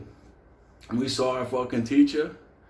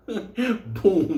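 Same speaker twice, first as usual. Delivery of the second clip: high, laughing boom; 7 semitones higher than usual. Retold or imitated. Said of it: "repeated sound".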